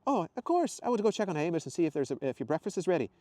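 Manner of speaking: high, hurried backing-out; flustered Scottish woman excusing herself; awkward. Acted. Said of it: "Isla said awkwardly".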